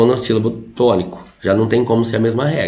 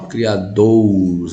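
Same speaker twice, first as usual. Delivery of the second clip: slow "criadouros", DOU drawn out; strong syllable stretched; 3 semitones lower, much slower than usual. Shown as a demonstration. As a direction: lean long on the DOU in "criadouros". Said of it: "é o forte". ("criadouros" lento, alongando a tônica DOU)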